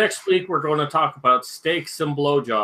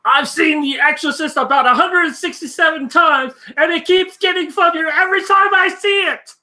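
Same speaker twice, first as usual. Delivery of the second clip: high, loud raspy quote; Beetlejuice voice; 6 dB louder than usual. Retold or imitated. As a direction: shout it like a manic ghoul. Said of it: "here's a good quote from him".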